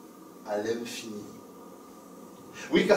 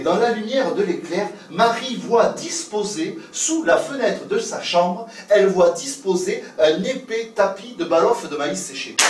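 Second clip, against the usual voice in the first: dramatic telling; 6 semitones higher, 5 dB louder than usual. A narrator's reading. Lightning-lit climax of the tale.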